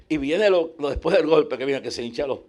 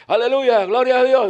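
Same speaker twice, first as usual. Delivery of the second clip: high, loud Pentecostal shout; imitating loud praise; 8 semitones higher, 7 dB louder than usual. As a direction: mimic a worshipper crying out praise. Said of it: "tenía que mandar callar a la gente".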